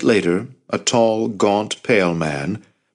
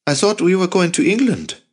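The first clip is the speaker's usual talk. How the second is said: put-on boy voice; 11 semitones higher than usual. A narrator's reading. Jacob voiced higher.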